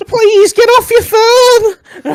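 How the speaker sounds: put-on very high, slow pleading old dear; old lady. Old lady asking the driver to stop, slow high old-dear plea. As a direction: stretch it out like a frail pensioner. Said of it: "That's what she's doing".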